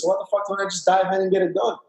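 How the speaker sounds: ordinary talk, nothing marked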